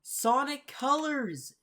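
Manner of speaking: catching something familiar mid-video, sounding thrilled; excited recognition. Real, not acted. Reacting to the comic, spotting a Sonic Colors machine, excited and drawn out. Recognizes a game robot design.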